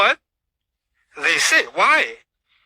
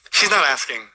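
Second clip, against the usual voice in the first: usual speaking voice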